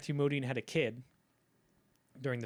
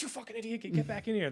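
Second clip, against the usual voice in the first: alarmed scolding; 9 semitones higher than usual. Acted. Gabriel covers his mouth, scolds him.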